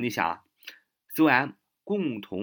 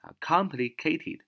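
slow model word; 6 semitones higher, slower than usual. Shown as a demonstration. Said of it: "它的形容词complicated".

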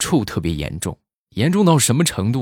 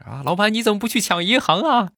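much higher, mock outraged; hammed-up jab; 12 semitones higher than usual. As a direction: squeal the punchline at the boss. Repeats punchline with exaggeration.

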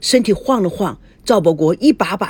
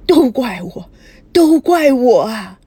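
crying, louder, slowly dragged out; wailing sob; 4 semitones higher, 5 dB louder, slower than usual. Acted. Grandma sobs out her guilt to her husband, wailing. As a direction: break down blaming herself. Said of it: "说着说着嚎啕大哭起来".

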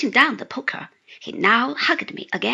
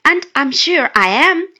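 bashful Sophie; 4 semitones higher, 7 dB louder than usual. A narrator's reading. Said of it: "said the blushing and laughing Sophie".

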